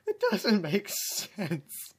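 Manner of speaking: very slow, high exasperated complaint. Real, not acted. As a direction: drag it out in disbelief.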